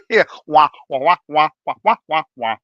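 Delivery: loud adult-voice wah wah imitation; Charlie Brown muffled wah. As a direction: mimic a muffled trombone voice. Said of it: "So kind of Charlie Brown".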